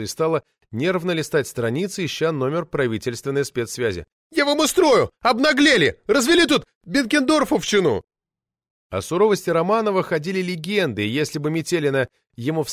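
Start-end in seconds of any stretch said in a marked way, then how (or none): 4.3-8.0 put-on high, loud indignant shriek of an old party lady; shrill furious official lady yelling; roaring outrage. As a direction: howl it out like an enraged sabre-toothed tigress.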